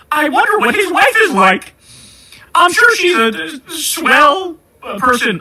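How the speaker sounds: high, sugary sarcastic wondering; mock curiosity. Host voices the country wondering about an official's wife, mock naive. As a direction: put on a high, naive voice, dragging "swell".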